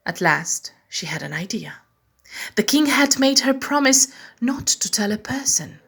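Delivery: plain reading voice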